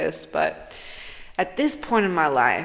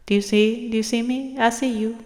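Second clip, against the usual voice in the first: playful greeting; 6 semitones higher than usual. Retold or imitated. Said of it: "and I'm like".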